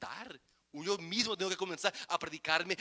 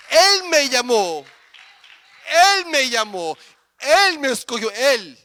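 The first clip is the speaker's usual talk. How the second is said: loud, rising sermon cry; preaching at full force; 10 semitones higher, 18 dB louder than usual. Acted. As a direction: proclaim it to the congregation. (predicando a todo pulmón)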